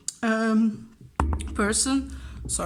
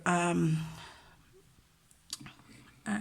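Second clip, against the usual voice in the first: normal talking voice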